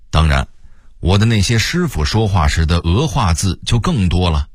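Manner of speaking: usual speaking voice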